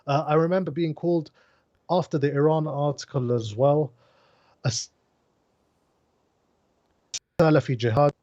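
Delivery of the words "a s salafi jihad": breaking up on a call; choppy internet audio; bad connection. Real, not acted. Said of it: "You're breaking up".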